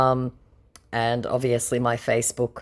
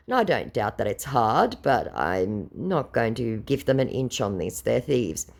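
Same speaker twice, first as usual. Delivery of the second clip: ordinary delivery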